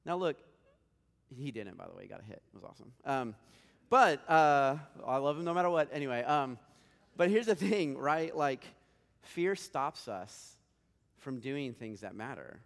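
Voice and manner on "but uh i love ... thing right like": louder, higher pulpit push; big preacher emphasis; emphatic preaching. Acted. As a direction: lean into the crowd with it. Preacher raising his voice.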